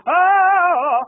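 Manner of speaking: loud, high sung burst; operatic high note. Acted. Comic closes the aria loudly. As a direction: hit a big opera note. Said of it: "singing opera".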